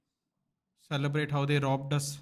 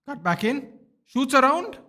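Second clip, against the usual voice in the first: rising, louder call as the shot comes; live fan commentary swelling to a shout; excited commentary; 10 semitones higher, 8 dB louder than usual. Real, not acted. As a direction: build to a yell on the shot. Live chance in the match.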